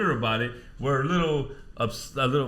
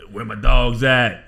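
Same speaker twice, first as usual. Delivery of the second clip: slow, loud mock call of New Yorkers copying the slang; 3 semitones lower, 9 dB louder, much slower than usual. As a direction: drag it out loud, mocking them for copying. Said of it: "What happened six months after".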